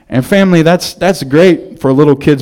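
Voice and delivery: normal talking voice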